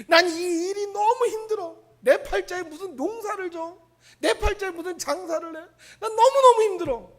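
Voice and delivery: loud, high lament about one's lot; whiny complaint. Retold or imitated. Preacher voicing a person lamenting their hard work. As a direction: wail about fate. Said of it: "힘이 든 사람은요".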